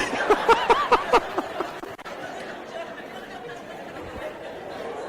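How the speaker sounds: breathy high laughing burst; unvoiced high laugh at the listeners; real laugh. Real, not acted. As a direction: laugh at the nosy crowd. Preacher laughs at curious congregation.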